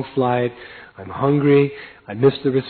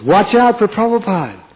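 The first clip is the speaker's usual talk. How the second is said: warning; 9 semitones higher, 6 dB louder than usual. Retold or imitated.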